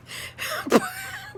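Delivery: high breathy giggle. Real, not acted. Teacher giggling at his mother driving stick, high giggle. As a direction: giggle helplessly. Laughing at his own story.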